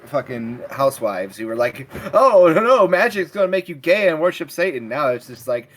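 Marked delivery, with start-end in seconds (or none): 2.1-4.9 loud, high mocking housewife voice. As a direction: clutch pearls like a stuffy housewife.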